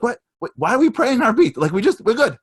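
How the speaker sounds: high, loud indignant whine; mock whiny complaint. Rabbi imitating a worshipper after Kippur, mock whiny.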